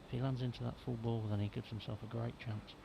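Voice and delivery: ordinary delivery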